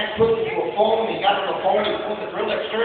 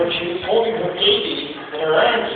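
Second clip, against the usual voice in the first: ordinary talk, nothing marked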